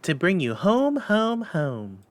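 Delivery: put-on high, eager child's voice; little girl voice. A narrator's reading. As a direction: voice a delighted little sister. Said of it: "said the child, brimful of glee".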